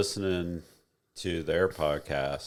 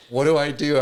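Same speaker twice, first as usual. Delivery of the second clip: loud, high, baffled mock question; mock confused; 10 semitones higher, 10 dB louder than usual. Retelling a friend's panic over surprise fries, mock bewildered and loud. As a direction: play him lost and helpless, loudly. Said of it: "And he's like".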